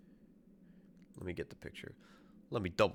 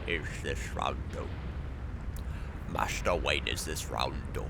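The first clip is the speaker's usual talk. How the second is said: butler voice; 7 semitones lower than usual. Pretend. Gamer asking Master Wayne in a low mock butler voice. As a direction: play it as a lowered butler aside.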